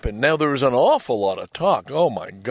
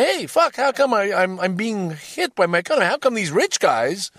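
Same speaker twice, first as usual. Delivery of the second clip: higher and louder, fed-up ordinary Russian; a lightly voiced angry Russian man asking why; aggrieved Russian citizen; 9 semitones higher, 5 dB louder than usual. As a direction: complain like a citizen hurt by sanctions.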